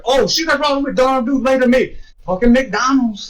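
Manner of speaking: high, excited retold realization; exaggerated astonished recognition; mock amazement. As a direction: blurt it out in hyped-up awe.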